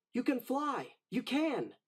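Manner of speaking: will him into the air; Timothy urging Dumbo to fly; urgent encouragement. Acted. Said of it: "cried Timothy".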